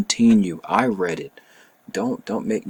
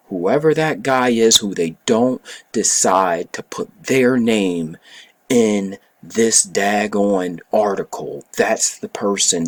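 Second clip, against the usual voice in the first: heated rant; 2 semitones higher, 6 dB louder than usual. Real, not acted. Ranting about the unnamed secretary.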